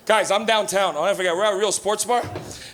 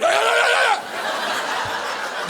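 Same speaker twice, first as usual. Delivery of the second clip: copying the crackhead. Retold or imitated. Trying to fit in with a crackhead, mock yeah yeah. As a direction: blend in by copying him, pitched way up. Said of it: "and you're like".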